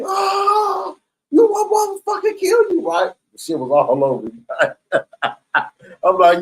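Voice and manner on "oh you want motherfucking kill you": much higher, squealing mock officer voice; panicked officer. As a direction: squeal it like the startled guard. Officer squeals after tray thrown.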